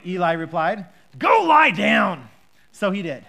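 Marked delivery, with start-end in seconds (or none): 1.2-2.3 slow, loud grumpy order; grumpy Eli. Acted. Eli gruffly sends Samuel back to bed, loud and slow. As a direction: bark it out like a sleepy, cross old man. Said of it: "a very grumpy voice on Eli".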